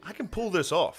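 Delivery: high, sure-of-himself mock voice; cocky comic. Mocking open-mic guys, high cocky voice. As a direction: sell the bad idea with false confidence. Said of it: "they're like".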